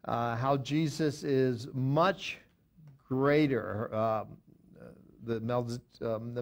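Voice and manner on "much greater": "much greater" drawn out slowly for emphasis; stress on MUCH GREATER. Real, not acted. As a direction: weigh "much" and "greater" separately, with a pause between. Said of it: "all caps to, uh, emphasize that".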